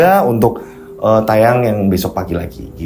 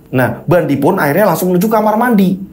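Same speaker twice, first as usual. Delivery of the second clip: high-pitched, suspenseful storytelling; dramatic ghost-story narration; 10 semitones higher than usual. A narrator's reading. Storyteller recounts Bandi's night drive, dramatic ghost-story narration. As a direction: drive the horror tale along.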